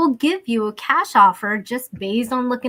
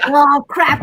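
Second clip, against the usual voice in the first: louder, higher embarrassed oh crap; embarrassed exclamation; 5 semitones higher, 9 dB louder than usual. Real, not acted. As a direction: blurt it out, caught off guard. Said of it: "Did I just snort on the show?".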